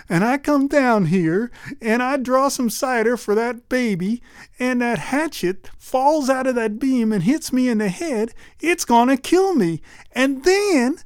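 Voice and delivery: put-on high, whimpering girlish worry; tearful girl voice. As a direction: spin the fear up toward tears. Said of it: "bawling her eyes out".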